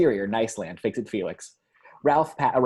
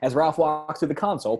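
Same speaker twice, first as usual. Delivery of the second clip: usual speaking voice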